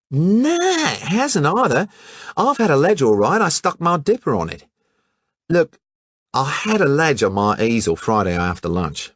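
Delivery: breezy colonial lad; Hatchett's Australian voice. Acted. Hatchett answering the detective casually, brash Aussie voice.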